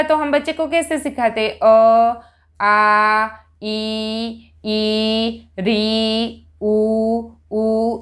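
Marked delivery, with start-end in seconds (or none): 1.6-8.0 vowels rattled off with no length difference; the way not to teach it; vowels listed without holding the long ones; wrong flat vowel recital. Shown as a demonstration. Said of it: "तो ऐसे नहीं सिखाना है".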